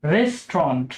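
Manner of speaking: রেস্টুরেন্ট as REST-ront; quick recap of রেস্টুরেন্ট; correct pronunciation. Shown as a demonstration. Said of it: "শব্দটি আবার একটু দেখি".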